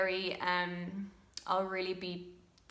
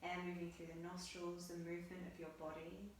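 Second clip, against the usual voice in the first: soft, hushed meditation guidance; guided meditation; 15 dB quieter than usual. Acted. Yoga teacher guiding students through breath on their mats, soft meditative voice. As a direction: guide the class gently into breath awareness.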